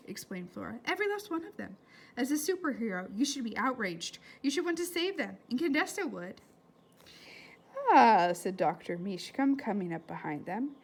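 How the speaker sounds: put-on lively read-aloud to children; story reading. A narrator's reading. Teacher reading a novel aloud to her class, lively. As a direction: bring the chapter to life for the class.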